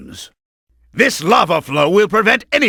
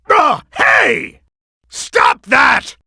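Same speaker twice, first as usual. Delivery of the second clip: alarmed protest; 5 semitones higher, slower than usual. Acted. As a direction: shout at them to stop. Boss being attacked.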